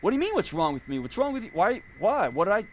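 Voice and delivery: hammed-up indignant husband; mock indignant. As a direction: play the wounded innocent, pitch climbing. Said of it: "I'm gonna act indignant".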